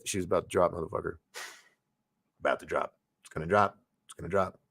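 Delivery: low, grim muttering doom; cynical inner voice. Retold or imitated. His cynical side predicting the collapse, low and grim. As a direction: growl it like the doubting side. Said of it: "the other side's like".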